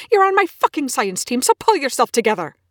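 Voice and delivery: loud, high, swearing yell; furious shout. Acted. Scientist yelling at his entranced colleague, furious shout. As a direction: bark at her to pull together. Shakes possessed colleague to wake her.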